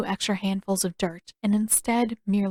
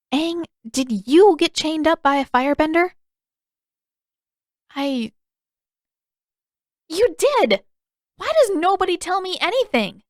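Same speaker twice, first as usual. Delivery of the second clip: much higher, louder outraged questions; indignant Katara; 8 semitones higher, 6 dB louder than usual. Acted. Katara discovering Aang hid his capture, indignant and loud. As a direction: build to an exasperated outburst.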